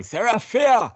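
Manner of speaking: hammed-up shout with bad accent; mock shout. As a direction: call out like an angry townsman. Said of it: "Man number eight is shouting".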